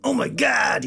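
exaggerated American exclamation; mock American cry; bad American accent. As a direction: attempt a hammy American accent. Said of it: "my attempt at American accent".

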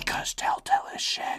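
unvoiced little interjection in a whisper; whispered aside. Real, not acted. Co-host heckling the storyteller about Telltale, whispered.

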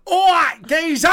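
high, loud hammed-up Essex geezer; Essex accent. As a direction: ham up the Essex accent on request. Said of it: "Ham it up, twenty percent".

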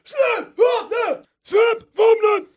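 high, slow hoarse cop yell; mock cop screaming at a suspect; slow police shout. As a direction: drag out the police command, full voice. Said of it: "Utan alltid".

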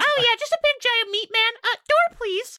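high hag excuse; Hagatha voice. Acted. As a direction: whine it like an old hag.

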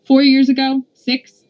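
dismissive, snorting comeback; scornful snort. A narrator's reading. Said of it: "Polly M snorted".

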